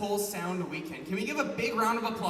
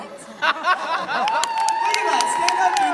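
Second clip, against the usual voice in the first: much higher, much louder delighted shouts; excited; 13 semitones higher, 11 dB louder, much slower than usual. Real, not acted. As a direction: yell with delight at the poster. Excited at a fan's poster.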